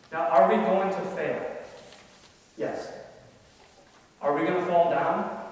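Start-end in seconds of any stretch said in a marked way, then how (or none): none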